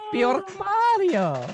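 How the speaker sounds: very high, slow, otherworldly [name] voice; [name] impression. To the co-hosts, possessed by Eldrad, as a very high, slow [name]. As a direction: coo it like [name] playing an alien. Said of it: "[name] and Mario next".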